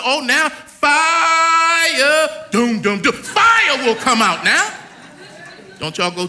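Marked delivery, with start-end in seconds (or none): 0.0-4.7 booming, slow and drawn out; God's voice. Retold or imitated. Preacher booming God's words to the church. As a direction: thunder it out as the voice of God. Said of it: "God said".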